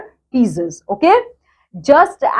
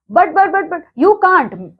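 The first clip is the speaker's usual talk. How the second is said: shocked stammer; 8 semitones higher than usual. Retold or imitated.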